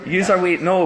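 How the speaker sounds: ordinary delivery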